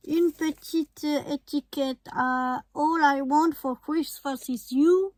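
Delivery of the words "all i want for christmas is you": English read with a strong French accent. Real, not acted. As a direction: read the English words the French way, mock proud. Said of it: "avec mon accent parfait".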